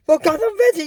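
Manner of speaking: high-pitched battle call; excited cartoon attack; shouted spells. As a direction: cast the spell in a high cry.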